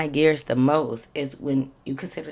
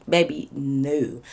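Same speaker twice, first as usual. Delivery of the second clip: slow dismissive no; 2 semitones lower, much slower than usual. Real, not acted. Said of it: "dismissive aside".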